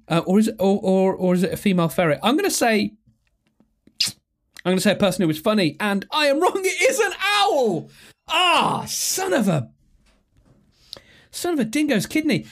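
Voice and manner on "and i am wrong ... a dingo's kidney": high-pitched, exasperated cry at being wrong; exasperated outburst. Real, not acted. Quiz player finding out the guess was wrong, exasperated wail. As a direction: wail it in comic frustration.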